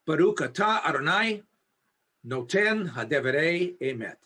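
slow recitation, long pauses between phrases; slow Hebrew blessing. Real, not acted. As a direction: recite it slowly, phrase by phrase, so listeners can join in. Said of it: "I'll say it slow enough".

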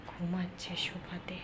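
slow real whisper. Real, not acted. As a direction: breathe the words out without voice. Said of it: "এখন আমি আস্তে আস্তে কথা বলছি".

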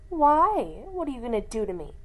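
loud, fast taunting challenge; loud rapid defiant taunt; defiant snarl. A narrator's reading. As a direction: rattle it off daring him. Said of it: "she snarled".

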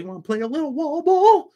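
very high squeaky inmate voice; squeaky, high-pitched voice for his friend; wall ball line. Retold or imitated. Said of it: "[name], say the wall ball line".

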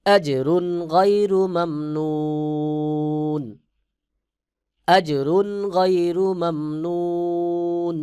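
slow melodic tilawat, long held notes; Quran recitation. Shown as a demonstration. Teacher recites the verse melodically for students. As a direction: chant it slowly, sustaining the vowels. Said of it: "সহি উচ্চারণ হলো".

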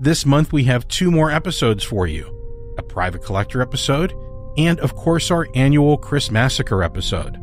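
ordinary delivery

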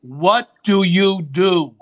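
loud, slow, drawn-out grilling; confrontational demand. Retold or imitated. Idol cornering a nervous opener before the show, loud demand. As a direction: plant each word loudly and slowly.

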